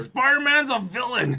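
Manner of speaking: high mock-outraged accusation; ranting TV pundit. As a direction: chime in like a shouty TV host. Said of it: "this guy shouts off crazy stuff".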